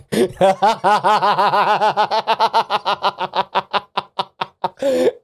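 long rising giggle; laughing fit. Real, not acted. Game master losing it over the roll, rising giggle fit. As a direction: let the giggle run.

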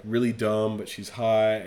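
normal talking voice

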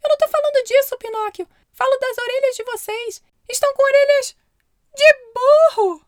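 high, loud squeaky cricket alarm. Acted. Grilo alarmado. (voz aguda de grilo alarmado)

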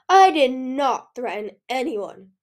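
loud, indignant denial; indignant protest. A narrator's reading. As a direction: protest it hard, word by word. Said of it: "Pearl protested".

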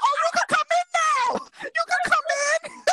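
high, sing-song welcome at heaven's gate; a hammed-up Jesus, beaming and calling her in; mock Jesus welcome. Jesus welcoming the friend into heaven, hammed-up. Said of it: "Jesus will be like".

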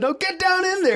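high, strained sergeant yell. Retold or imitated. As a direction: imitate a sergeant yelling down at a recruit. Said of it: "yelling down in the trash can".

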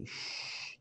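slow, soft drawn-out "shh" for the S sound; Mayo shh. Shown as a demonstration. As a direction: hiss out a long "shh" like a Mayo speaker. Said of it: "They emphasise on the S H".

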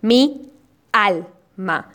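"Mi alma" broken as mi, al, ma with pauses; separated syllables. Shown as a demonstration. Teacher reviewing the example for listeners, "Mi alma" in separate syllables. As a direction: keep "Mi" apart from "alma", syllable by syllable. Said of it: "Revisemos en nuestro ejemplo".

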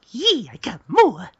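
high, drawn-out gleeful squeal; Kagura. Retold or imitated. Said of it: "I love how Kagura's like".

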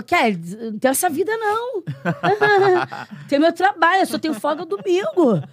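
husband's laughing refusal. Retold or imitated. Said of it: "E ele falou".

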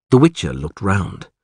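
ordinary delivery, nothing marked

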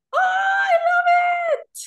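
high delighted shout; excited shout. Real, not acted. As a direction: squeal with delight. Delighted reaction to the blush.

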